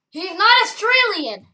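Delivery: loud, high denial; emphatic high-pitched protest; insistent shout. Pretend. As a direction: raise pitch and volume to insist. Insistent denial about companion.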